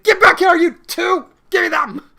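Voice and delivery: loud, high furious shout; angry yelling. Acted. Samus yells at the kidnappers, furious. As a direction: roar after them in hot pursuit. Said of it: "It's like".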